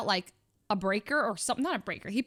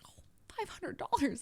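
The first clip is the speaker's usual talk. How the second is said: higher, softer startled echo; retold price shock; 6 semitones higher, 6 dB quieter than usual. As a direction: repeat the price, taken aback. Her reaction to the price.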